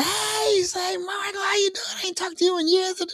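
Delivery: a delighted mother shouting hello down the line; high, excited phone greeting from a fan; excited mom voice. Retold or imitated.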